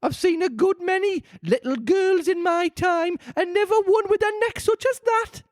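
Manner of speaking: high, scornful bird voice; contemptuous pigeon. A narrator's reading. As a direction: sneer it down at her.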